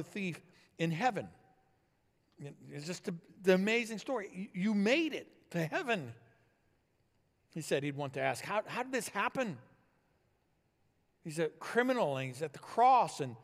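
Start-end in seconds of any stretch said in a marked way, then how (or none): 4.3-6.1 higher-pitched astonishment, stumbling start; amazed. Acted. Acted amazement at meeting the thief. 8.4-9.6 high-pitched, incredulous stammer; astonished question. Acted. Acted astonished question to the thief.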